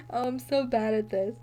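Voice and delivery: ordinary delivery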